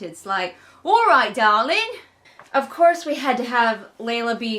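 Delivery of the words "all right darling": put-on loud, silly Tobias accent; hammed-up endearment in a Tobias accent. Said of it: "with my silly Tobias accent".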